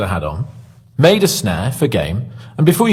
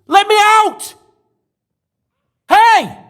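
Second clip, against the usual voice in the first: yelling for help; slower than usual. Acted. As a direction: shout to be let out. Prisoner yells through cell door.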